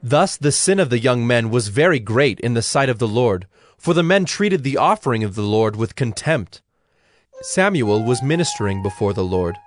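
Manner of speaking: ordinary delivery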